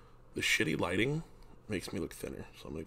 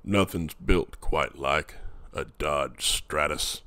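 slow, low truck-ad voice; slow, gravelly-intended ad read in a cowboy style; slow mock truck commercial voice; Sam Elliott impression; 3 semitones lower, 6 dB louder, much slower than usual. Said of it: "It sounds nothing like him".

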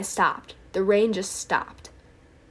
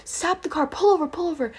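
high-pitched panicked plea, imitating mom; mock panic, voice jumping up; retold freak-out; 8 semitones higher, 4 dB louder than usual. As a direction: act out a frantic mother begging the driver. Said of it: "freaking out".

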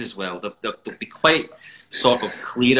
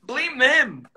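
mock defensive; 6 dB louder, slower than usual. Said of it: "I was like".

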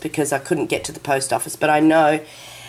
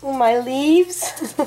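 touched; 6 semitones higher, much slower than usual. Real, not acted. Recognises leaves from home.

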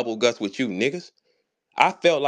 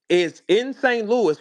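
ordinary talk

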